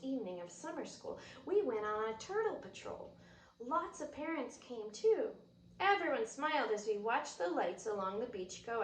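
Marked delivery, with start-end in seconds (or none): none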